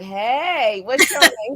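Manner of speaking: mock flirtatious greeting, lifted pitch; imitating smooth-talking dad. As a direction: play the ladies' man chatting someone up. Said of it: "he start talking good".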